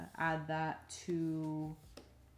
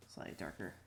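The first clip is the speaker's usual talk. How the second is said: much lower, soft mutter; muttered to self; 12 semitones lower, 10 dB quieter, faster than usual. Real, not acted. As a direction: mumble it under your breath. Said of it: "Get a slightly darker color".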